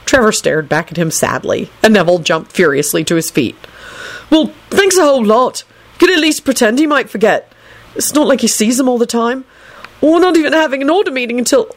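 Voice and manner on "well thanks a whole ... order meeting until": high-pitched sarcastic protest; indignant. A narrator's reading. Neville rebuking Trevor for not reassuring him, indignant. Furious, rebukes the unsupportive toad.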